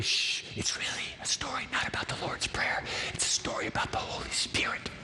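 conspiratorial whisper sharing a secret. Real, not acted. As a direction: lean in and whisper it.

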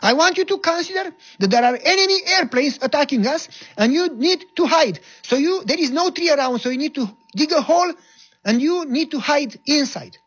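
ranting captain. Retold or imitated. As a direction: order him around mockingly. Captain justifying order loudly.

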